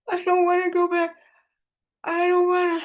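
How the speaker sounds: put-on high, childlike tearful protest; sobbed reply. A narrator's reading. Fugitive refuses to go back to jail, sobbing. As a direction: whine it tearfully, high as a little kid. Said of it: "Bookie sobbed back at him".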